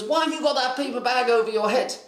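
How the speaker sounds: high, mock-exasperated demand; indignant questioning. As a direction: imitate a baffled scolding. Father's questioning acted out.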